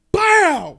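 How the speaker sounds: loud, high "bow" smack; explosive mock impact sound; imitated impact noise. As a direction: shout out the crash. Imitates hitting the rock.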